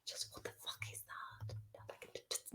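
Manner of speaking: exasperated whisper. Real, not acted. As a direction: hiss it under your breath in disbelief. Exasperated aside at the definition.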